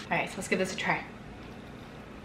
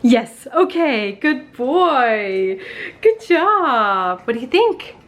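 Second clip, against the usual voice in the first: dog-praise voice; 3 dB louder, much slower than usual. Real, not acted. Training dog Gary.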